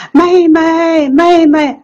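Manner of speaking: high calling voice from far behind. Retold or imitated.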